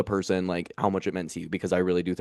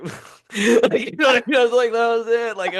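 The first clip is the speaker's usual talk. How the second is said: much higher, louder, laughing; overwhelmed squeal; 14 semitones higher, 6 dB louder than usual. Real, not acted. Comedian reliving the starfish pin moment, squealing.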